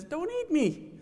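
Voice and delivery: high, squeaky little voice from inside a peach; talking peach. Acted. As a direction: pipe it up high like a tiny fruit talking. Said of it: "a voice coming from the peach".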